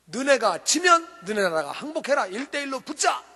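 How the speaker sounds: loud, high-pitched taunting call; booming challenge. Acted. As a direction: call out a giant's challenge to the enemy army. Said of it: "골리앗이 나와서 큰 소리로". (큰 소리로 싸움 거는 골리앗)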